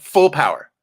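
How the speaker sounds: FULL POWER punched high and loud; emphatic repeat. Real, not acted. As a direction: belt out "Full power" with conviction.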